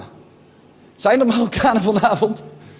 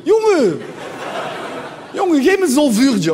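lightly voiced street guy with "zolvuurtje" z; Z-heavy "zolvuurtje" in street slang; moroccan dutch street; 4 semitones higher than usual. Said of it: "Ook een zolvuurtje".

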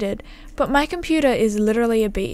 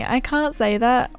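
ordinary delivery

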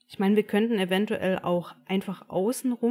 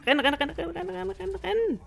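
panicked high shouting; being chased; 11 semitones higher than usual. Real, not acted. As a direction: shriek it while fleeing. Being chased again in game. (panisch gejagt, schreiend)

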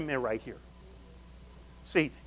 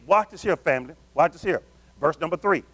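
ordinary talk

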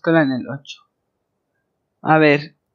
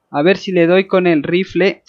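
ordinary talk

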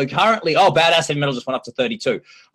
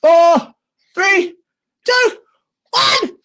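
rising louder and higher to a shouted finish; excited countdown; 6 dB louder, much slower than usual. Real, not acted. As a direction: count down like a game show host. Countdown to vote close.

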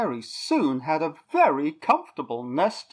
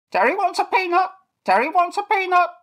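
very high, loud, fast squawk; squawky bird cry; 7 dB louder, faster than usual. A narrator's reading. Toucan begging Eric for peanuts, high fast squawk. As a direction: rattle it off like a parrot. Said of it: "the voice cried out".

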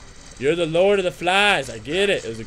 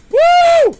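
shrill, very high, loud victory whoops; wild whoops; 14 dB louder, much slower than usual. Real, not acted. Gamer celebrating a new power, wild high whoops. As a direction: scream it with pure joy.

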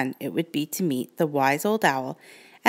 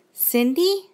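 put-on uncertain girl call; 11 semitones higher than usual. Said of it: "said Brooke, peering around".

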